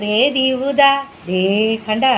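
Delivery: usual speaking voice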